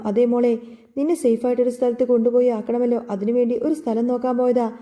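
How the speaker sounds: plain reading voice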